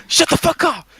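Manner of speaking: high-pitched hammed-up yell; mock yell. Quoting a clip for laughs, high mock yell.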